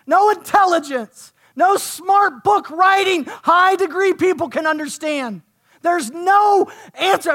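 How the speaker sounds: high, loud preacher's cry; impassioned preaching. Real, not acted. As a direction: drive each phrase home to the congregation at full force. Preaches at full force.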